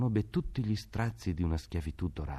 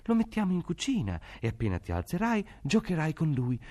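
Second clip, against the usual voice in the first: coaxing mother; 8 semitones higher than usual. A narrator's reading. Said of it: "si curvò su lui spaventata".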